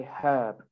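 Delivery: "herb" as HUHB, R silent; British, no R. Shown as a demonstration. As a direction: sound the H in "herb", drop the R. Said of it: "You don't say the R".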